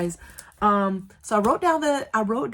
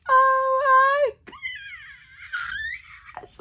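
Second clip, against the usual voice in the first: high, louder tearful joy cry; 5 dB louder, much slower than usual. Real, not acted. Finally reading the acceptance with her husband, joyful crying. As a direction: let the joy break into tears. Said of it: "so excited".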